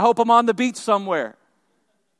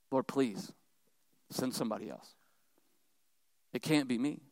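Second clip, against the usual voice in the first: low, very soft acted plea; quiet pleading; 9 semitones lower, 14 dB quieter than usual. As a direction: beg softly, pitch dropped right down. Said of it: "Moses again pleaded with the Lord".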